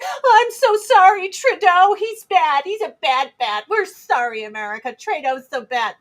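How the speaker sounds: high, loud, simpering mock apology; mock apologetic Canadian. Mocking imagined Canadians, a high simpering apology. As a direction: whine out a fake sorry. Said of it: "people don't come to America like".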